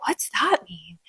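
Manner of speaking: bewildered whisper, barely voiced; whispered aside. Commentator reacting to the quote she just retold, whispered aside. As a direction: drop to a hushed, puzzled whisper.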